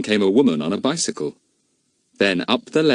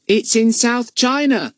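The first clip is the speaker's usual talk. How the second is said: put-on young boy; 3 dB louder, slower than usual. A narrator's reading. Telegraph boy speaks.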